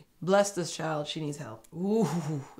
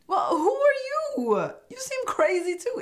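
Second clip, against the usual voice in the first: incredulous retort; 4 dB louder than usual. Pretend. Snapping back at Hannah's claim.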